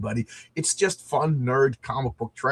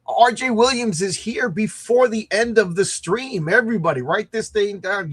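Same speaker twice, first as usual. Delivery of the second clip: announcer hype; 8 semitones higher, 4 dB louder than usual. Real, not acted. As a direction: announce the guest like a host. Announcing arrival loudly.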